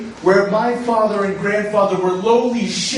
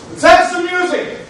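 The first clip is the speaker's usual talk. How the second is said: excited call; 9 semitones higher, 4 dB louder than usual. Acted. Celebrating, calling for the band.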